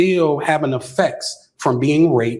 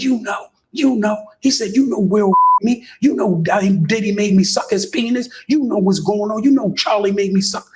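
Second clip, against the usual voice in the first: imitating crying; 5 semitones higher than usual. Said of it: "So he crying".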